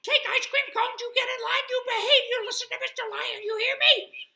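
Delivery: tiny creature's very high, fast shriek; squeaky screaming. A narrator's reading. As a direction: scold at full squeak, very loud and rapid. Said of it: "Alfred kept screaming in his ear".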